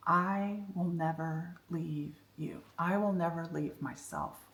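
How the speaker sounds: soft, slow, sincere promise; quiet heartfelt promise to yourself; sincere vow. Real, not acted. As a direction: say it with so much truth. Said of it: "with so much truth".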